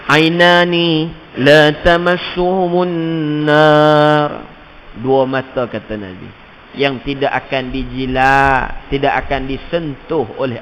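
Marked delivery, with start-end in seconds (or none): 0.1-4.3 Arabic recitation. Acted. Hadith recited.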